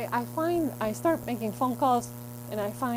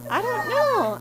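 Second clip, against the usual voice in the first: high, exasperated I don't know; frustrated outburst; 12 semitones higher, 6 dB louder, slower than usual. Acted. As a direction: throw up your hands, higher. Exclaims in frustration, then repeats slowly.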